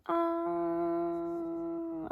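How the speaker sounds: high, steady held ahh; saying ahh. Shown as a demonstration. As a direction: hold a long open ahh.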